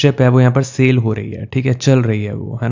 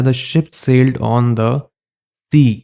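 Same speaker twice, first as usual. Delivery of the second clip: reads the English sentence word by word, very slow; slow reading; much slower than usual. Shown as a demonstration. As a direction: spell out the question sentence slowly. Reading quiz sentence to class. (धीरे-धीरे वाक्य पढ़ना)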